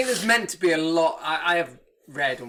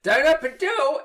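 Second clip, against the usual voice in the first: shouted Cockney, high and slow; Cockney accent; 9 semitones higher, 6 dB louder, slower than usual. Retold or imitated. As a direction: yell it like a Cockney. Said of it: "in a Cockney accent".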